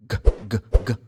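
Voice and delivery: hard "G" repeated as short separate bursts; isolated hard g sounds for "G"; letter sound demo. Shown as a demonstration. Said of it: "That's the sound".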